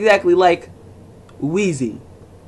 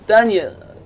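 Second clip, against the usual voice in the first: slow, lingering "Dunya" savoured like a crush; mock swooning "Dunya"; drawn-out name; 4 semitones higher than usual. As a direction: stretch out "Dunya" lovingly. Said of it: "I love the way you say my name".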